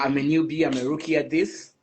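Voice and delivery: ordinary delivery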